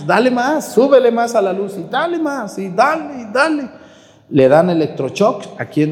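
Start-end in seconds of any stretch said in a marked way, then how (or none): 0.1-3.7 high, urgent doctor pushing for more power; urging doctor. Retold or imitated. Doctor at the defibrillator urging more shocks, high and urgent. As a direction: play a medic yelling to crank it up. Voicing doctors during resuscitation.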